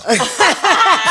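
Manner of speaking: short loud high laugh; quick loud high laugh; high laugh burst. Real, not acted.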